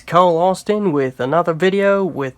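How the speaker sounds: hammed-up Aussie accent, lightly done; tongue-in-cheek Australian accent for the intro; corny Australian accent. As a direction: play up a jokey Australian twang. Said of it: "such a corny Australian accent".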